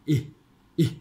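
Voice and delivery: อิ as a brief, airy English short i; short released vowel. Shown as a demonstration. Teacher showing the English sound, short released vowel. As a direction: let the air out on อิ, no tight stop. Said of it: "อันนี้เสียง".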